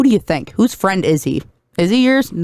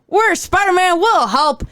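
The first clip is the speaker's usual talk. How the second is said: higher, cheery heroic offer; hammed-up heroes; 11 semitones higher, slower than usual. Other Spider-Men offering help, hammed up higher. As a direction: play it as eager superheroes.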